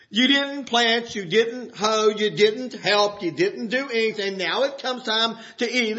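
normal talking voice

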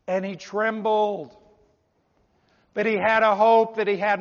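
slow, ringing sermon delivery; forceful preaching. Real, not acted. Preacher telling how Felix put off salvation, forceful preaching. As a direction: drive the scripture home, drawing out key phrases.

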